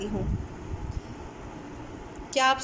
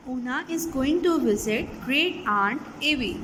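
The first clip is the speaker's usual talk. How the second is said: slow reading; much slower than usual. Shown as a demonstration. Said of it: "teacher rereads slowly for kids".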